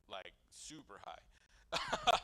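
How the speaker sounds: can't get it out for laughing. Real, not acted. Confessing to the audience, laughing.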